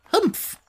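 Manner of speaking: a squeaky disapproving humph; high grumpy humph. A narrator's reading. Sand Fairy huffs at the children, high squeaky humph. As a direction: huff it out high like a cross little creature. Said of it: "said the Sand Fairy".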